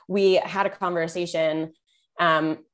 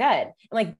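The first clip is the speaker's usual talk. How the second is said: usual speaking voice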